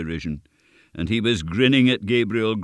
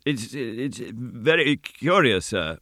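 high, slow, halting reply; frightened sexton; 5 semitones higher, slower than usual. A narrator's reading. Sexton humours the goblin, frightened halting voice. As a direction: stammer politely through fright. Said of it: "half dead with fright".

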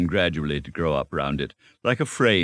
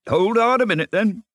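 high, loud call; calling to wait; 5 dB louder than usual. A narrator's reading. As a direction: call out to halt a friend. Rat calls to eager mole.

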